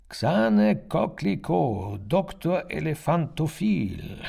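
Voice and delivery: high, slow call into the phone; loud call. A narrator's reading. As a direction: call out her name. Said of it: "rief ich so laut".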